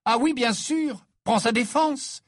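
high, loud, cutting comeback; indignant, high-pitched snap; curt retort. A narrator's reading. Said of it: "répliqua sèchement Ginny".

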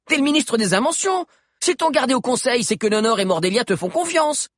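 put-on high, fast, bossy kid; brash and impatient, hurry him along; bossy girl voice. Sabi voiced by narrator. (voix aiguë de gamine autoritaire)

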